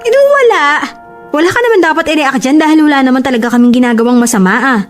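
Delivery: high, defensive arguing; indignant protest. Acted. Girlfriend dismissing boyfriend's jealousy, indignant.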